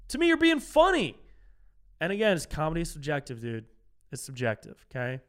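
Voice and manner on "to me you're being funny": 1 emphatic answer. Real, not acted. Heated rhetorical answer.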